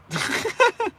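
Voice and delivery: high squeaky laugh. Real, not acted. Laughs after calling himself old git.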